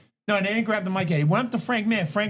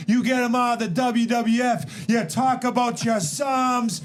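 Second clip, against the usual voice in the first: Stone Cold impression; 6 semitones higher, slower than usual. Redoing the Austin 3:16 promo for cohosts, slow and shouted. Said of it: "how he came out there and goes".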